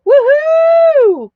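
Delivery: very high, loud whoop; excited woohoo. Real, not acted. Host celebrating a buyer's purchase, loud high whoop. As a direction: whoop like your team just scored.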